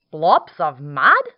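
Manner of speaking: loud, amused dingo; booming sly dog character. Acted. Dingo voiced.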